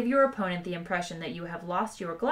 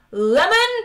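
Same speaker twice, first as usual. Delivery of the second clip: high, explosive bellow; loud, high, enraged query; furious shouted question; 11 semitones higher, 12 dB louder than usual. A narrator's reading. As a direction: bark one word like an interrogation. Bellowing interrogator continues drink questions.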